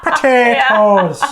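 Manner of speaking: high, drawn-out comic Irish; slow, hammed-up Irish accent; Irish leprechaun accent. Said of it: "they're kind of Irish".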